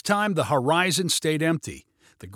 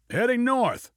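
high, easy call; casual shout; 9 semitones higher than usual. A narrator's reading. Hitchhiker answers pilot, casual shout. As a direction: call back high, conversationally, without extra volume. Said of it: "shouted the hitchhiker".

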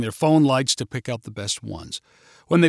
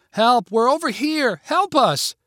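calling for help; 9 semitones higher, slower than usual. Acted. Gabby calling to rescuers in the maze, shouting. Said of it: "she began calling like Max".

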